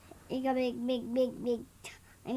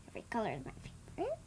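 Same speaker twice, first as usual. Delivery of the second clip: soft, barely audible mumble; too quiet; 6 semitones lower, 12 dB quieter, much faster than usual. Real, not acted. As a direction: says it under her breath, too quietly. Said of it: "You need to talk a little bit louder".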